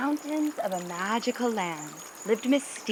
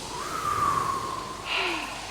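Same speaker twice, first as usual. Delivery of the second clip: long breathy exhale to calm down; deep calming breath; 9 semitones higher than usual. Acted. Yeti calming herself before standing up, deep exhale. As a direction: breathe out slowly to settle. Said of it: "Yeti took a deep breath".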